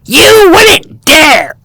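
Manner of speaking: high, slow, outraged bird shriek. Acted. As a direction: stretch out a furious squawk. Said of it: "shrieked Oxpecker".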